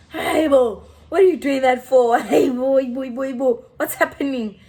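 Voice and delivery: loud, repeated mock outrage; indignant storytelling outburst; retold protest. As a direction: act out a heated objection. Protesting friend being thrown out.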